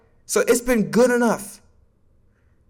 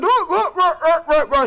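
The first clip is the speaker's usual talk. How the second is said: mock chatter; 9 semitones higher than usual. Mocking critics' chatter.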